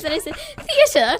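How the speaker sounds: laughing through a mock English accent; put-on English accent. Shown as a demonstration. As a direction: keep the posh English accent going, giggling. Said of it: "That's that amazing, uh, English accent".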